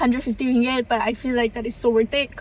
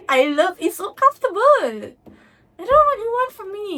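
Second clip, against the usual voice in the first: playful defensive whine; 9 semitones higher, 4 dB louder than usual. Real, not acted. Defending her outfit to viewers.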